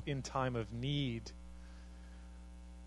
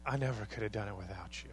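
grateful; 5 semitones lower, 9 dB quieter than usual. Retold or imitated. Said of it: "and you say".

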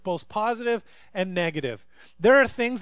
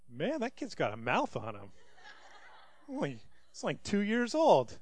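mock heartfelt; 8 dB quieter than usual. Pastor telling his brother about the kid, laughing mutter. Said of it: "from the depth of my heart".

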